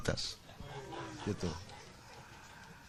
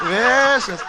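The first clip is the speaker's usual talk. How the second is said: loud laughing; 6 semitones higher, 5 dB louder than usual. Real, not acted. Laughing at his own mischief.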